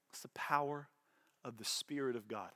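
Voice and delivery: an unvoiced, reverent whisper; awed whisper. Real, not acted. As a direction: breathe the words out in hushed awe. Hushed awe about God's power.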